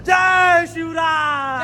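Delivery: high, long-drawn rallying shout; slogan shout. Real, not acted. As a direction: lead the group in a drawn-out chant. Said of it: "एक नारा होऊ दे का". (जोरदार, लांब ओढलेली घोषणा)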